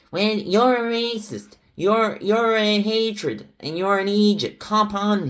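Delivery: ape a poser's put-on Irish countryside accent; mocking fake rural Irish accent. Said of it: "in his fake accent, overly countryside accent".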